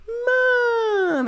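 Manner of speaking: put-on a little girl whimpering Mom high and teary; crying child. Little girl cries for her mom after losing the banana, imitated whimper. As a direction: wail it like a hurt toddler. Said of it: "the little girl starts crying".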